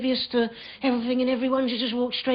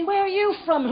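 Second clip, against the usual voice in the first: lightly voiced higher-pitched stranger; small-talk stranger; 6 semitones higher than usual. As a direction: pitch it up for polite chit-chat.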